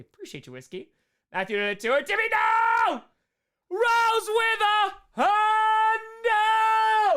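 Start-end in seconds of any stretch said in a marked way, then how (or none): 2.0-7.2 high, loud, drawn-out hype yell; excited shout. Real, not acted. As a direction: shout it out over the big donation. Reacting to big donation.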